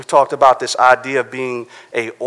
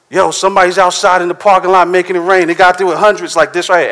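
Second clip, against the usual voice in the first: higher and louder, hyped-up announcement; excited hype; 5 semitones higher, 5 dB louder, faster than usual. Retold or imitated. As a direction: burst in hyping the free cash. Hyping up church about free money.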